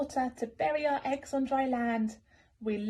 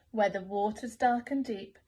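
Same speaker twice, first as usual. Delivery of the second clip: ordinary delivery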